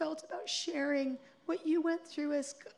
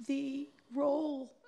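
normal talking voice

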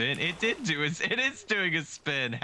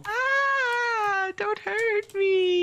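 high, slow, whimpering plea; mock pleading; 4 dB louder, much slower than usual. Begging the other player not to hurt him, mock cry. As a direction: beg for mercy in a hammed-up cry. Pleading after crab taken.

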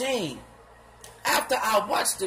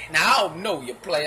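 usual speaking voice

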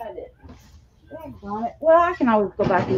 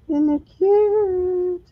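cooing; 9 semitones higher, much slower than usual. Real, not acted. Showing off her butterfly.

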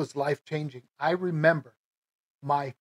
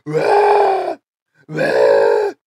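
dramatic dry heave; 13 dB louder than usual. Retold or imitated. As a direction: ham up the dry heaving noise. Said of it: "I have to be dramatic about it".

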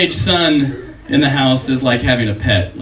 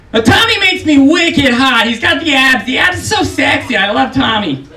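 put-on Boston accent; 13 semitones higher, 4 dB louder than usual. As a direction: swoon over Tommy in a thick Boston accent. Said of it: "she said".

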